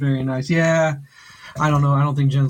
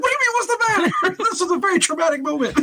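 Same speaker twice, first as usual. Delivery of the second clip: mock outrage; 13 semitones higher, much faster than usual. Indignant reply to wife.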